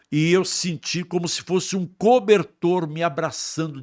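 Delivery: slow, loud, high testimony; preaching. Acted. Preacher testifying to the church, slow and fervent. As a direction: lift the congregation with each phrase.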